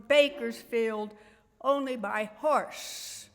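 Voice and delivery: measured, projected poem reading with long pauses; poetry recital. Acted. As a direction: declaim it to an audience, stretching key words. Reads a poem to an audience.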